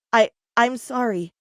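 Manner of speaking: plain reading voice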